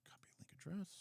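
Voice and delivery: whispered to himself while clicking; a quick whisper to himself, off mic; whispered aside. Real, not acted. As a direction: breathe it under your breath. Muttering to himself at computer.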